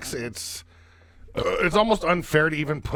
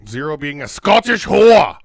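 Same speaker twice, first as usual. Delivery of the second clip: loud punchline; 11 dB louder than usual. Acted. Crude joke punchline, loud.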